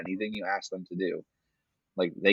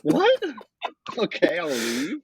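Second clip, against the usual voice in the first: high, slow, puzzled mock Duncan; a baffled player shrugging off the ejection; Tim Duncan bewildered; 10 semitones higher, 4 dB louder, slower than usual. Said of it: "Tim's just like".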